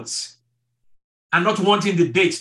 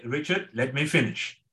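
quietly imitating a calm wife, softer and lower; a gentle, patient hold-on; 4 semitones lower, 5 dB quieter than usual. Wife calmly asks husband Richard to let her finish, softly imitated. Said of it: "she would very calmly say".

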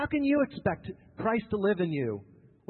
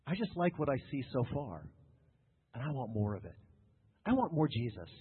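ordinary talk, nothing marked

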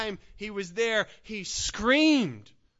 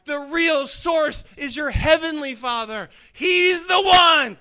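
loud, higher, forceful sermon climb; preaching at full force; 6 semitones higher, 9 dB louder than usual. Acted. Preacher urges the congregation to cry out to God, loud. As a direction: drive it home to the congregation.

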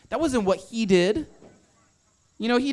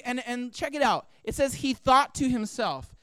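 ordinary talk, nothing marked

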